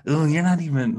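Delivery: high, loud mock taunt; imitated arguing woman. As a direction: mimic an overdubbed argument. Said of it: "She's like".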